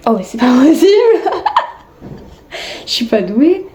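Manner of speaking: laughing through the words; giggly frustrated self-mockery. Real, not acted. Laughing at own failure.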